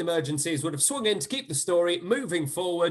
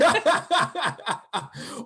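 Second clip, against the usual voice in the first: real, higher-pitched burst of laughter; hearty laugh; 5 semitones higher than usual. Real, not acted. Host cracking up at his own silly pun, real laughter.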